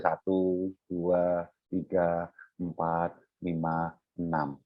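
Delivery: soft, low, slow inward count; hushed, unhurried counting; counting in one's head. Shown as a demonstration. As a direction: count slowly to yourself, dropping low and quiet. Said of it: "dalam hati aja".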